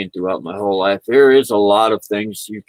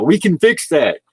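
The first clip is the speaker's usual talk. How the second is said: high-pitched, mock-eager offer; hammed-up cheery doctor's promise; eager surgeon; 7 semitones higher, 4 dB louder than usual. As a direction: pipe up like an overkeen surgeon. Acting surgeons offering knives.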